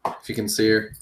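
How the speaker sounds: usual speaking voice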